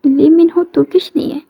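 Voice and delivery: a hushed whispered question. Acted. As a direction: say it under your breath, unvoiced. Aside in the conversation.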